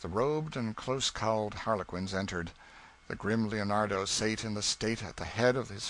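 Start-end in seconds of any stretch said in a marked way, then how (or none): none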